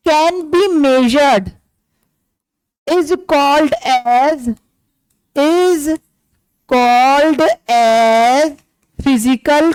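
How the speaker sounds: very slow, word-by-word dictation; teacher dictating a definition slowly. Shown as a demonstration. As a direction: spell out the definition for notes. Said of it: "यहां पे लिख देता हूं definition".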